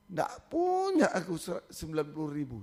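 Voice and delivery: student crying to the pastor about the fee; crying plea. Retold or imitated. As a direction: voice breaking into tears on the plea. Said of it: "Sama-sama nangis".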